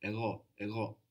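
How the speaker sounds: Ego with a soft G that hangs in the throat. Shown as a demonstration. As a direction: say Ego, letting the G stay soft and far back in the throat. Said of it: "It's like a soft G, which hangs around in the throat".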